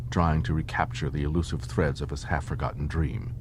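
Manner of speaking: plain reading voice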